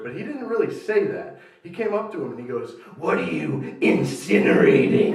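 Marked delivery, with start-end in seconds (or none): 3.0-5.1 slow, louder, laughing misspeak; slow dumb misspeak. Retold or imitated. Dumb guy mangling the word, slow and loud. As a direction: say the wrong word proudly. Wrong-word version of quote.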